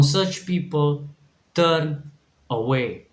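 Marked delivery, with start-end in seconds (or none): none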